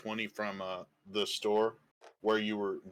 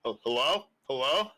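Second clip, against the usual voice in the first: groggy calling out; 4 semitones higher, 5 dB louder than usual. Acted. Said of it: "he wakes up going".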